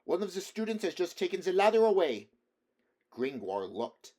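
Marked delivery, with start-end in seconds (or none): 0.0-2.3 higher, louder complaint; stranded actor complaining to the poet; plaintive. A narrator's reading. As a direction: plead his case pitifully.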